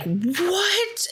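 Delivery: mock alarm at something moving; startled squeak. Group startled by creature.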